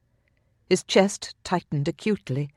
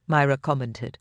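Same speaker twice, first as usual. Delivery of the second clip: plain reading voice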